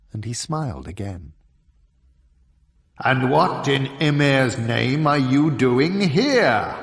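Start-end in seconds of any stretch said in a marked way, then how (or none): 3.0-6.8 slow, loud and suspicious giant; a looming giant sizing him up; frost giant voice. A narrator's reading.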